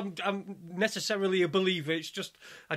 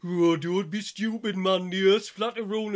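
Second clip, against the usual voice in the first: louder imitation of a scoffing lad; mocking; 5 dB louder than usual. Young lad ridicules him over Flat Earth, mocking. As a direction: take the piss in a put-on lad's voice. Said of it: "he starts taking piss out of me".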